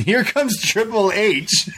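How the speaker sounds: high, slow, through laughter; drawn-out, laughing storytelling; laughing reveal. Real, not acted. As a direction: announce it high, breaking into laughs. Amused reveal of Triple H.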